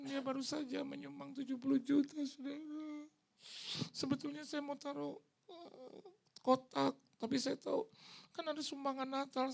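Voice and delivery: high, preachy announcement voice; imitating the pastor. Pastor announcing to congregation, mimicked.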